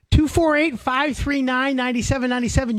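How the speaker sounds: high, sing-song phone number; radio host reading the call-in number; announcer number read. Real, not acted. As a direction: pitch it up like a jingle.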